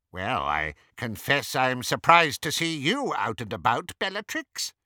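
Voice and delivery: cool wizard expressing guarded surprise; formal, probing courtesy from a suspicious acquaintance; Travers, cool wizard. A narrator's reading. Voices Travers in the story.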